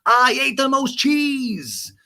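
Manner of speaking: high, loud, slow whiny sitcom rant; George Costanza. Retold or imitated. Recapping a cheese fight as a neurotic sitcom rant. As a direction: hammer it out like a petty sitcom neurotic. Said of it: "It's like George Costanza".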